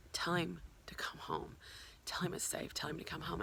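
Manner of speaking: hushed, breathy whisper sent out into the air; soft whispered command, barely voiced; whispered plea. Real, not acted. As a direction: whisper it like a prayer into the night. Sending energy, channeling a message.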